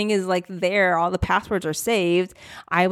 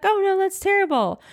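high, sing-song mock sympathy; a light take on mother-in-law's pity; imitated sympathy; 14 semitones higher than usual. Mother-in-law hearing about the lost laptop, high mock sympathy.

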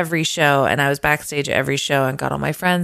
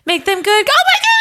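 much higher, louder squeal; squeaky high fangirl squeal; 5 dB louder than usual. Retold or imitated. Singer squealing at spotting her idol mid-song. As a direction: shriek it like a starstruck fan. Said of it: "my voice went up about four octaves".

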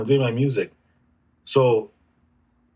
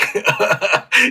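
a real, high burst of laughter; bursting out laughing at the confession; hearty laugh; 7 semitones higher than usual. Real, not acted. Laughs at own admission.

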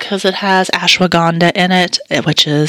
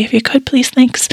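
whisper; 8 semitones higher than usual. Real, not acted. Whispered plea to Netflix.

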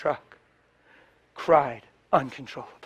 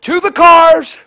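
high, loud hammed-up rallying shout; laughing mock battle cry; mock retreat cry; 13 semitones higher, 16 dB louder, slower than usual. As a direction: bellow like a general sounding retreat. Mocking the group's retreat.

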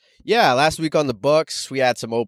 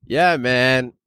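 normal talking voice

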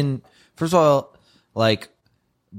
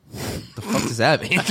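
slow, higher, laughing through it; laughing mock retort; 13 semitones higher, slower than usual. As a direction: say it while cracking up. Norm's retort, retold laughing.

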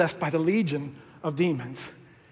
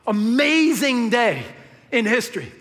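marvelling; 4 semitones higher, 4 dB louder than usual. Real, not acted. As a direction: stretch out the wonder. Preacher marvels at the moment.

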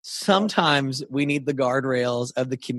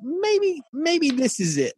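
high, drawn-out "maybe"; tentative mock doubt; 12 semitones higher, much slower than usual. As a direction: voice a wavering thought up high, slowly. Voicing a doubting thought.

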